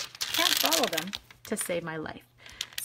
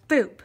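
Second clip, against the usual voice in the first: a short, louder "boop"; playful boop sound effect; boop noise; 3 semitones higher, 9 dB louder than usual. Shown as a demonstration. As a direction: pop out a boop as it lands. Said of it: "Make that noise".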